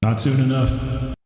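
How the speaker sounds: slow echoing canyon call; drawn out like an echo. Pretend. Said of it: "Can you do the echo voice again?".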